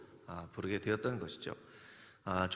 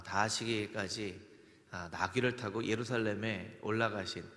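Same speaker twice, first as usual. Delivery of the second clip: ordinary delivery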